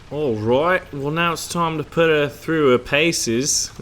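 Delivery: slow, hammed-up Australian drawl; terrible Australian accent. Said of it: "my terrible Australian accent".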